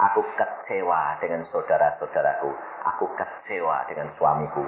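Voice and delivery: low, soft, heavy admission; subdued retold despair; disappointed confession. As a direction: voice the wife quietly confessing her hurt. Voicing the despairing wife.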